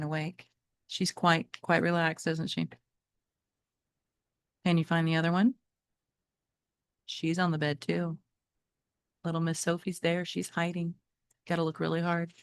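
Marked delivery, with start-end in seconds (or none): none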